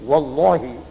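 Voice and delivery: Wallahi with a thick, heavy lam; Wallahi, the lam made thick; thick lam oath. Shown as a demonstration. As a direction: swear Wallahi with a full dark lam.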